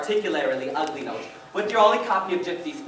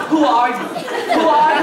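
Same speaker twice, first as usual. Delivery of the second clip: mock alarmed cry; 9 semitones higher, 9 dB louder than usual. Imagined inner thought to spouse.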